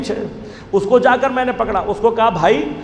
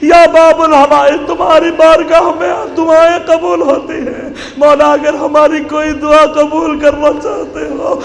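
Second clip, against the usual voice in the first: impassioned preaching plea; 5 semitones higher, 4 dB louder than usual. Real, not acted. Preacher's closing supplication.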